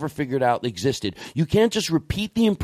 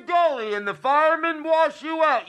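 Doctor Phil; 13 semitones higher than usual. Pretend. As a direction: deliver folksy nonsense wisdom like the TV doctor. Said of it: "impersonation of Doctor Phil".

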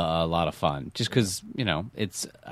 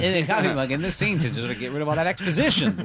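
jokey; 9 semitones higher, faster than usual. Pretend. Jokey line about a mug in scene.

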